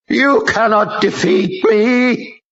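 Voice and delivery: high, slow, strained demon scream. A narrator's reading. Possessed strong man screams at the general, slow demon scream.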